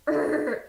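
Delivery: short annoyed groan; frustrated groan. Real, not acted. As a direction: let out a fed-up urgh.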